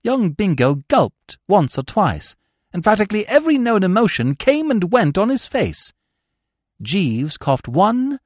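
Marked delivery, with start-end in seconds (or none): none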